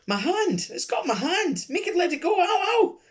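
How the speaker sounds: shrill, high screaming cries of pain; faked pain scream. Old lady fakes pain to distract the room, shrill screaming. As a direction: scream as if something is biting your hand.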